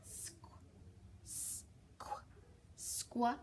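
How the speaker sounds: squ- whispered then voiced, blending; sounding out. Shown as a demonstration. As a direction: tease out the first sounds softly. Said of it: "when we try to sound that out".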